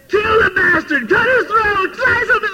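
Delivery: high, loud, slow yelling through tears and laughter; retold furious yelling, half laughing; screaming at the screen. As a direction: scream at the movie villain. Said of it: "I'm screaming out".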